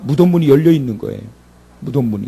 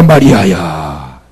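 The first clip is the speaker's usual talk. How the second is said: much lower, slow, drawn-out 마리아야; tender low call; 8 semitones lower, 4 dB louder, much slower than usual. Acted. Jesus repeats Mary's name, low and slow. Jesus calls Mary again.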